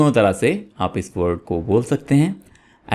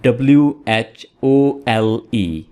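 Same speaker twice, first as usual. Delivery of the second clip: spelling it out. Shown as a demonstration. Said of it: "एक होल ये है और एक इसी से मिलता जुलता होल है".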